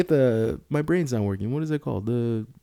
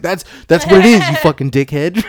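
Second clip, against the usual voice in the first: loud, raised mock-indignant comeback; exasperated retort; 5 semitones higher, 9 dB louder than usual. Retelling a comeback to a friend mocking the word, loud exasperation. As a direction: snap back in mock outrage.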